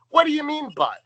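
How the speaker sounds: high, loud indignant interruption; mock-outraged butting in; [name]. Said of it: "[name] interrupts her".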